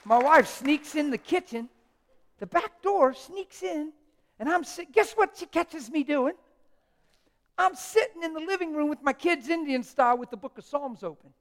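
loud, high preacher's fervour; preaching at full force. Acted.

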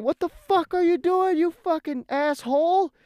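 very high-pitched imitation of a furious brother; angry cussing. Hurt brother to driver after the landing, cussing furiously. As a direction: cuss him out in a strained, high voice. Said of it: "he's just cussing at me".

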